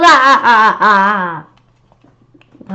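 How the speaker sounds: loud staccato villain cackle; evil cackle. Acted. Monster gloating about ruling the world, evil cackle. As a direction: cackle like a madman. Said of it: "An evil cackling laugh".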